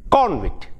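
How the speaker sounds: CONvict, stressed on CON and falling; first-syllable stress. Shown as a demonstration. Teacher showing convict used as a noun, first-syllable stress. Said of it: "the stress is here".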